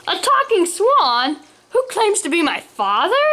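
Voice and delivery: high, disbelieving questions; lifted voice full of incredulity; incredulous. A narrator's reading. Son incredulous at talking swan.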